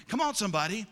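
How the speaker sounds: normal talking voice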